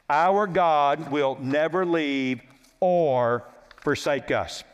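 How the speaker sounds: slow, solemn repeat. Real, not acted. Preacher to congregation on God's promise, slow and solemn. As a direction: let the promise sink in, word by word.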